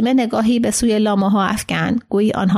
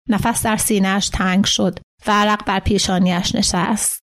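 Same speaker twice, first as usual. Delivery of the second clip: ordinary delivery, nothing marked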